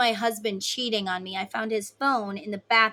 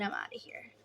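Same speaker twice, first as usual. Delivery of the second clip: much softer and lower, a whispered decision to leave; whispered exit line; 11 semitones lower, 13 dB quieter than usual. Retold or imitated. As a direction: slip out quietly, done with it. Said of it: "You're like".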